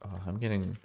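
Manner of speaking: ordinary talk, nothing marked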